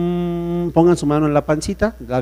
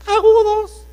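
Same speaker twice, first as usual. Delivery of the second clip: much higher and louder falsetto; 7 dB louder, slower than usual. Shown as a demonstration. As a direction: shoot agudos way up high. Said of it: "tesitura, agudos". (agudo, en falsete)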